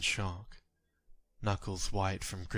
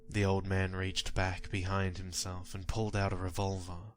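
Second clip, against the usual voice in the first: ordinary talk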